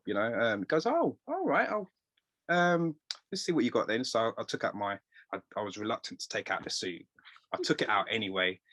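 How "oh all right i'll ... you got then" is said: much higher, lightly imitated posh manager; a hint of a traditional English tailor. Posh tailor agreeing to look at the suit, lightly imitated. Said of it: "very, very posh".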